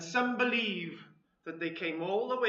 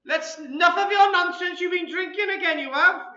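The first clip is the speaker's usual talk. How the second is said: loud, high scolding Welsh wife; Welsh accent; 9 semitones higher, 10 dB louder than usual. Acted. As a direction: scold him in a sing-song Welsh accent. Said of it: "in a Welsh accent".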